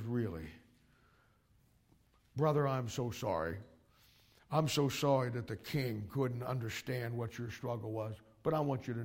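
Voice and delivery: soft, oily consoling voice; Absalom's fake sympathy. Absalom consoles the disappointed man to win him over, soft and oily. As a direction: pour on a quiet false sympathy.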